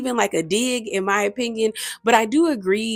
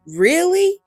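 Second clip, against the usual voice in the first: incredulous; 10 semitones higher, 4 dB louder than usual. Retold or imitated. Catty reality show argument retold.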